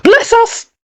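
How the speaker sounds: high-pitched startled exclamation; hammed-up alarm; startled mayor's cry. A narrator's reading. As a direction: jump at a sudden noise. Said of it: "cried the mayor".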